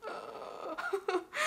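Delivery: tearful laugh. Real, not acted. Overcome by emotion.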